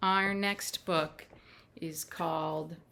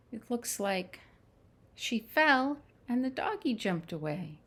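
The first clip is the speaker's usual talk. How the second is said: ordinary delivery, nothing marked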